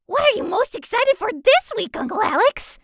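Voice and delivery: very high, eager little voice; squeaky excited niece; enthusiastic kitten. A narrator's reading. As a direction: ask brimming with excitement. Kitten Riley, very enthusiastic, asks.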